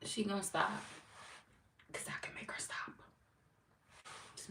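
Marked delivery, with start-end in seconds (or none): 1.9-4.5 secretive whisper. Real, not acted. Streamer hinting she'll block the troll, whispering. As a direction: let them in on a secret, breath only.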